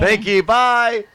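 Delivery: slow high thanks while escaping; high, slow, loud relieved thanks. Retold or imitated. Let go by the cop, a slow high relieved goodbye. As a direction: drag out the thanks while scurrying off.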